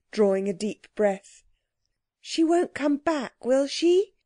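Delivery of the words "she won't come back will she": put-on high, anxious child's question; tremulous question. A narrator's reading. Jane fears the Queen will return, tremulous high question. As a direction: raise it into a timid, slow plea. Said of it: "asked Jane tremulously".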